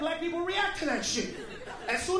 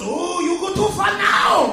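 mock outrage; 4 semitones higher, 10 dB louder, slower than usual.

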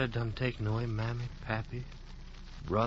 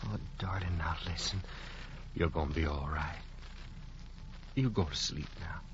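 low, soft and tender; calm her gently to sleep; soothing; 6 semitones lower, 7 dB quieter than usual. Acted. Adam soothes his dying love to sleep, low and tender.

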